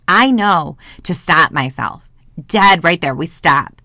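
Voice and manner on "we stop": usual speaking voice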